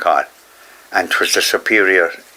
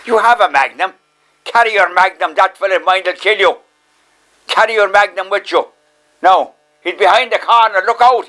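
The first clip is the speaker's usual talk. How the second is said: mock urgent warning; 9 semitones higher than usual. Catherine warning him, high excited.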